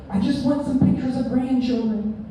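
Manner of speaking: high pleading mom voice; mocking his mother. Comic imitates his mother nagging about grandchildren, high and pleading. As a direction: whine it like a mother wanting grandkids. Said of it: "She's like".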